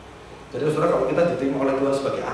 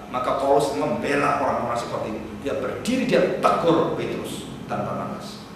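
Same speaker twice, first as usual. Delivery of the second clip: ordinary talk, nothing marked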